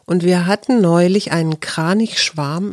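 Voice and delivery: ordinary delivery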